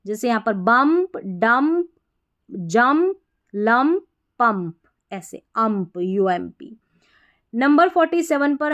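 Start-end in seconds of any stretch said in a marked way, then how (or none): none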